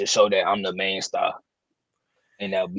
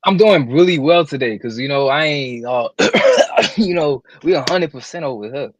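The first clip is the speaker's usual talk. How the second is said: loud hyped greeting; excited; 3 semitones higher, 7 dB louder than usual. Real, not acted. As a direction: come in pumped up.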